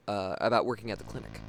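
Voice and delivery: usual speaking voice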